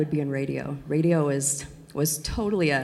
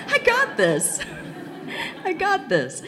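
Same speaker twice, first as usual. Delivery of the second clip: laughing; 14 semitones higher, slower than usual. Retold or imitated. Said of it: "I'm going".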